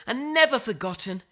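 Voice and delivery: ordinary delivery, nothing marked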